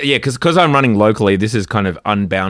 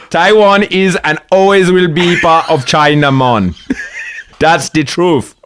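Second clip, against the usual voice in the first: put-on high, loud, over-the-top Jamaican accent; 7 semitones higher, 6 dB louder, slower than usual. AI declaring Taiwan part of China, hammed-up Jamaican accent. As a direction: proclaim it in a booming mock Jamaican accent. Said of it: "but in a Jamaican accent".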